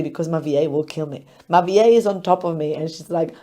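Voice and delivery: ordinary talk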